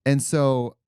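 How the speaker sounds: ordinary talk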